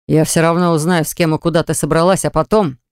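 plain reading voice

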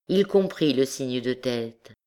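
ordinary delivery, nothing marked

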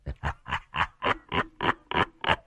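low, quiet ghostly cackle; mocking low chuckle; cackling laugh. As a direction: laugh in sinister staccato bursts. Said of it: "cười lên sằng sặc".

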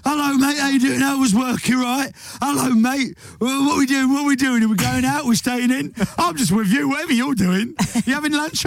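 high, breathless dog chatter, tumbling over itself; eager dog. Acted. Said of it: "But a dog will go".

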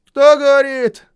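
very high, loud call from below; shouting. A narrator's reading. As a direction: shout up from the well. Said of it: "Из колодца донеслось".

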